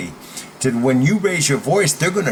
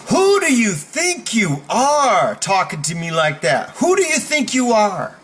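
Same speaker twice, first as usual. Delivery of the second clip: high, forceful rebuke; indignant challenge; 6 semitones higher than usual. Shown as a demonstration. Coach demos making a bully think, indignant challenge. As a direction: challenge them, climbing higher on the repeat.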